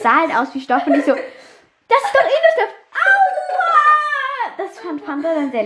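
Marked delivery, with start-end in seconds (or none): 1.1-2.7 very high, loud, drawn-out mock scoff; exaggerated dismissiveness. Storyteller replaying the remark made before the shock, exaggerated dismissiveness. 2.9-4.6 long, loud, very high "owww"; mock pain scream. Storyteller replaying the electric fence shock, mock pain scream.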